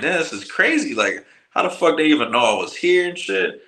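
high-pitched, bewildered protest; can't-believe-it retelling; retold disbelief. Retelling his disbelief at the arrest.

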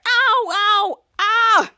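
loud, high cries of pain; mock cries of pain; pained yelps. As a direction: yelp "ow" like you're being hurt, retold. Acts out character in pain.

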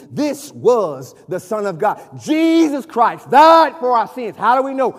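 loud, emphatic sermon delivery with stretched key words; full-force preaching. Real, not acted. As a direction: preach it out to the congregation, building in force.

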